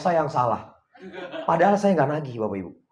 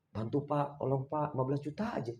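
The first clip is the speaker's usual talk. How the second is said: soft, pleading mock borrower; a soft mock plea for money; 11 dB quieter than usual. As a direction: beg quietly like the borrower. Imitating a borrower begging.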